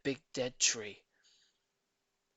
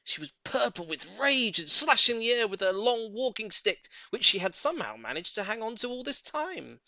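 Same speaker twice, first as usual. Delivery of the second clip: raised, louder storytelling; heated narration; 8 semitones higher, 6 dB louder than usual. A narrator's reading. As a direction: tell her fury with rising heat. Narrating her rage.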